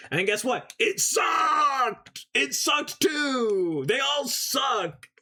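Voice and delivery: ranting about the movies at the top of the voice; shouting rant. Real, not acted. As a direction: howl it out in mock outrage.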